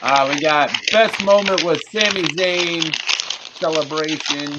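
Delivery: slow, loud, drawn-out words with gaps; halting delivery. Real, not acted. Reading out the best moment to co-hosts, slow and halting. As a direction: stall between words as you announce it. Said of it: "[name]'s, like, having a stroke over there".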